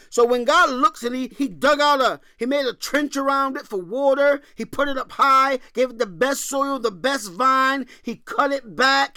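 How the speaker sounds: high, loud impassioned sermon; fervent preaching. Acted. Preacher to congregation, impassioned preaching. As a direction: preach it with fire.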